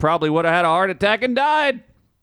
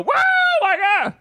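excited rock hunter; much faster than usual. Retold or imitated. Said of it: "and they go".

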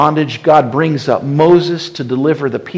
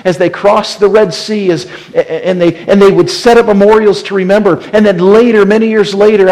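higher, louder, driving sermon voice; preaching at full force; 7 semitones higher, 7 dB louder than usual. Acted. Preacher rousing the congregation about the memorials, fervent and loud. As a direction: build the story up like a fiery preacher.

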